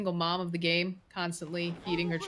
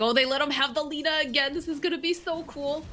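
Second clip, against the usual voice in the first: excited; 9 semitones higher, 4 dB louder than usual. Retold or imitated. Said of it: "And be like".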